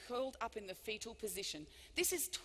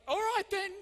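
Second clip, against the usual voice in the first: surprised; 9 semitones higher, 7 dB louder than usual. Retold or imitated. Her reply to the heckler.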